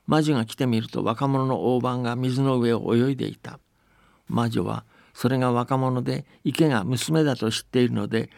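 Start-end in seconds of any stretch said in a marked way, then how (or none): none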